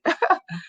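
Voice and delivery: a bright burst of laughter, high; quick amused laugh; short laugh. Real, not acted. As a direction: laugh at your own exaggeration. Laughs at his own joke.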